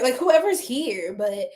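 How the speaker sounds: usual speaking voice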